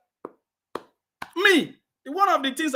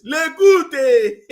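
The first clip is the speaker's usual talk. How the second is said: higher, louder call; 4 semitones higher, 9 dB louder than usual. Real, not acted. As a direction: shout his name. Calling out name again.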